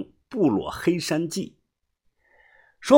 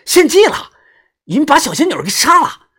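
高声怒吼; 掐着对方脖子怒吼; 愤怒嘶吼; 11 semitones higher, 6 dB louder than usual. A narrator's reading. 眼睛通红掐着脖子. (愤怒地大吼)

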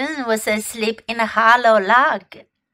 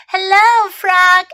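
higher, louder friendly call; little bear calling; 9 semitones higher, 7 dB louder than usual. Acted. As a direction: call out to Frog. Muslin greets, high loud.

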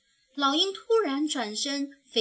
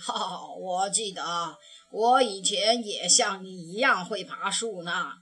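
fond grandpa; 4 semitones lower, 3 dB louder than usual. A narrator's reading. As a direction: lower old grandpa voice, a hint of amusement. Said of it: "熊爷爷笑起来". (熊爷爷笑着回忆往事，低沉)

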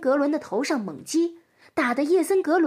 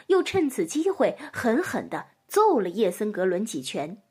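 usual speaking voice

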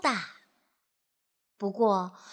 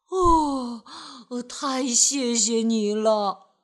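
relieved thanks; 3 dB louder, slower than usual. Acted. Relieved after rescue.

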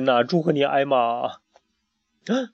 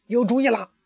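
high, bright burst of a sudden idea; the fox pipes up with a brainwave; excited idea; 11 semitones higher, 3 dB louder than usual. A narrator's reading. Fox suddenly thinks of a plan, excited high call. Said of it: "克瑞特又兴奋起来了".

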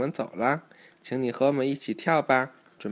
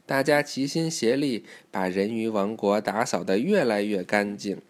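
usual speaking voice

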